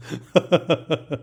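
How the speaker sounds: short run of laughter. Real, not acted. Speaker laughing about still not being paid, laughing. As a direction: laugh in a quick string of pulses.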